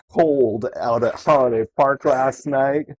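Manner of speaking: usual speaking voice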